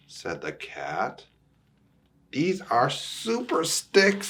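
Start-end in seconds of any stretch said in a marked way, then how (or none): 2.3-4.3 bird voice. Acted. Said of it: "says the bird".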